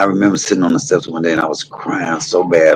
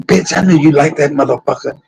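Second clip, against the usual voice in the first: jealous accusation; 10 semitones higher than usual. Retold or imitated. Jealous boyfriend explodes.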